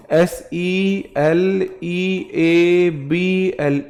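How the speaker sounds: each letter slow and separate; spelled out slowly. Shown as a demonstration. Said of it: "क्या करके question पूछा था". (अक्षर-अक्षर धीरे-धीरे बोलना)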